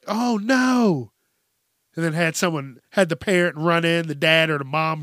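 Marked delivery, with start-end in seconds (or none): 0.1-1.1 higher, louder fake "oh no"; mock dismay. Kid pranking parents, fakes dismay higher and louder. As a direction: play a kid faking shock at a broken TV.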